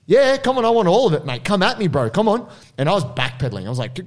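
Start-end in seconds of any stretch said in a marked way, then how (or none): 0.1-2.5 high, loud mock bravado; loud goading. Bystander baits aggressor away from old man, loud goading. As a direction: egg him on, voice raised high.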